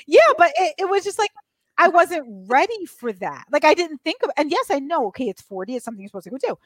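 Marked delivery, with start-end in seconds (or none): none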